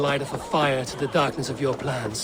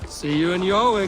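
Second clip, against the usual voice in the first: calling out; 3 semitones higher, 7 dB louder than usual. Acted. Shouting while racing.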